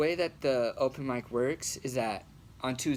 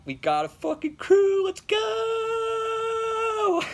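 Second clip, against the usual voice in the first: much higher, louder sung-out cheer; hyped yell; 9 dB louder, much slower than usual. Retold or imitated. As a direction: whoop it out like a rallying cry. Hyped about joining crew.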